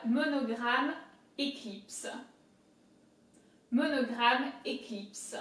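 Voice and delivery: "Monogram éclipse" in slow, careful French; French word drill with a throaty R; French pronunciation. Shown as a demonstration. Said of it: "heavy emphasis on the R".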